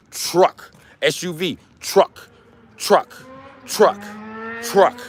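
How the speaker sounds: "Truck" said high with a sharp aspirated t; teaching truck. Shown as a demonstration. Streamer drilling the word truck for viewers, high and sharp. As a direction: snap "Truck" out like flashcards. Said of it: "Do you know what a truck is".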